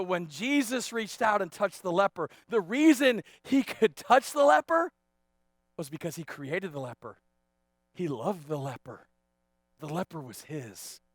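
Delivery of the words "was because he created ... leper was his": soft, low and sincere; hushed preacher's reassurance, spaced by pauses; tender. Real, not acted. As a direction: drop down gently for the reveal. Soft reveal after loud preaching.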